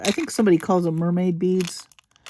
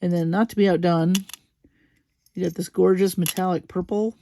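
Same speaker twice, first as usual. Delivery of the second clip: ordinary talk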